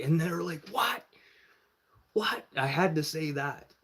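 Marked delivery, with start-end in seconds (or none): 0.7-2.5 high-pitched imitated "what"; high, questioning reaction; mock surprised. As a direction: squeak it like startled friends. Friends react to blunt question.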